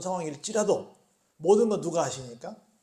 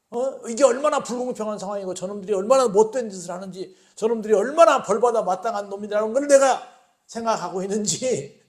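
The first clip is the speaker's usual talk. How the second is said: acted outrage, loud and raised, easing at the end; worked-up, insistent complaint; indignant appeal; 3 semitones higher, 7 dB louder than usual. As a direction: protest to God as a wronged prophet. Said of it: "얼마나 지금 화가 나 있는지".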